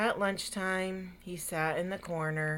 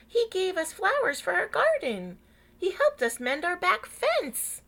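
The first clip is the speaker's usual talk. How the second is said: higher and louder, eager praise; 12 semitones higher, 8 dB louder than usual. Acted. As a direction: gush about what he did. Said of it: "insisted Mister and Missus Dunwoody".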